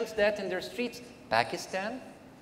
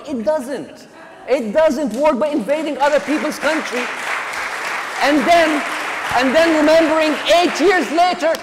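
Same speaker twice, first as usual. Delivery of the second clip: rising to a loud, high, heated rant; impassioned loud rant, pitch climbing; emotional outburst; 10 semitones higher, 7 dB louder than usual. Real, not acted. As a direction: let the frustration boil over, pushing higher and louder. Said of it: "I'm getting a little bit emotional".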